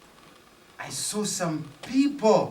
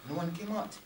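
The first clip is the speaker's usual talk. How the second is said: plain reading voice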